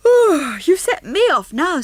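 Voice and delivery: talking through a big yawn. Acted. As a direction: stretch the words out in a yawn. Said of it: "an even larger yawn".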